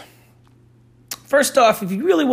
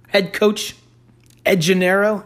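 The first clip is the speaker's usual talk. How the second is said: usual speaking voice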